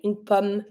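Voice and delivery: une pomme said regularly, stopping at the M; French pomme ending on M. Shown as a demonstration. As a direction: say pomme with a mute final E. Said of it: "you stop at the M".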